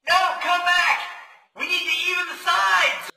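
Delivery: high, loud shout after someone leaving; strained, high-pitched yelling across a distance; desperate shout. Acted. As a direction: call them back at the top of your voice. Calling after someone who leaves.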